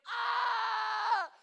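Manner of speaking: put-on loud high toddler cry; imitated toddler wail. Pastor mimicking his daughter's loud cry. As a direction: wail like a hurt toddler who finally lets go.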